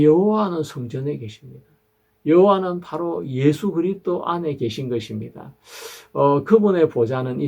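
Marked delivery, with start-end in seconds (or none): none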